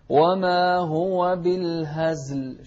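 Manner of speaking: slow recitation, buzzing z in بالهزل; vowelless zaay. Shown as a demonstration. Teacher modelling sukoon, بالهزل with no vowel after z. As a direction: chant بالهزل with z straight into l. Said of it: "شوف الزاي عليها سكون".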